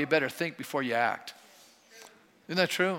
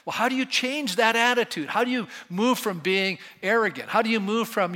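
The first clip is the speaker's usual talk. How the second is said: rising preacher fervour; 5 semitones higher, 4 dB louder than usual. Real, not acted. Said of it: "pressing the congregation".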